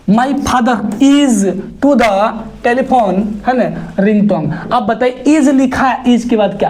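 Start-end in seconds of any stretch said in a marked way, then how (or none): 0.1-3.2 slow, word-by-word reading. Shown as a demonstration. Teacher reads the exercise sentence slowly to students. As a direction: drag the sentence out for the class.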